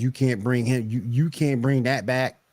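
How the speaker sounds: ordinary talk, nothing marked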